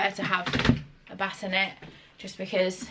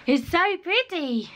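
gushing; 10 semitones higher, 4 dB louder, slower than usual. Real, not acted. Praising child's new skirt.

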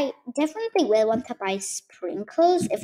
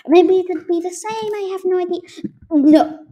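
flustered; 9 semitones higher, 10 dB louder than usual. Real, not acted. Panicking over the route.

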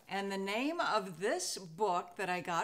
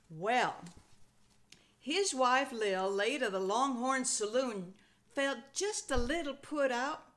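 folksy read-aloud with a cowboy twang; lively Old West narration for children; western storytelling; 8 dB louder than usual. A narrator's reading. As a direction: spin it like a campfire tale out west.

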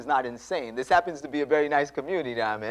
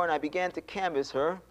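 usual speaking voice

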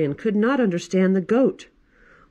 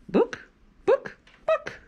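very high squawky hen clucks; clucking hen; slower than usual. Acted. Librarian reading to kids, clucking like a hen.

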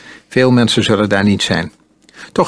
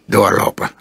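ordinary delivery, nothing marked

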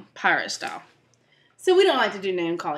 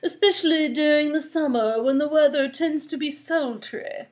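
prim rascal; 6 semitones higher, 3 dB louder than usual. Acted. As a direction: answer politely, nothing like a pirate. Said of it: "answered the man".

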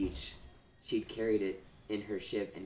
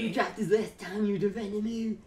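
put-on Mexican accent; 10 semitones higher, 4 dB louder than usual. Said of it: "can you do a Mexican accent?".

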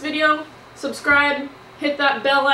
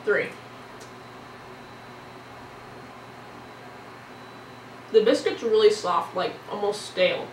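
talking between bites; just swallowing cake; slight mouthful of cake; 3 semitones lower, 4 dB quieter than usual. Real, not acted. Seen 2: Red-hoodie speaker bit cake just before, holds it while talking.

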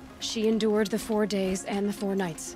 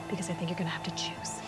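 whispering; 2 semitones lower, 6 dB quieter, faster than usual. Acted. Threatening whispered taunt.

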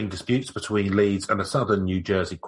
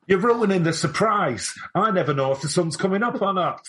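put-on much higher, fast old-timey voice; New Jersey pre-colonial accent; 9 semitones higher than usual. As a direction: whine like a frightened colonial farmer. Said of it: "It's a New Jersey pre-colonial accent".

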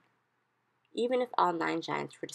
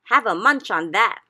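much higher, much louder taunting shout; gleeful shout; 5 semitones higher, 13 dB louder than usual. A narrator's reading. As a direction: hurl it down the pit. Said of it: "he shouted".

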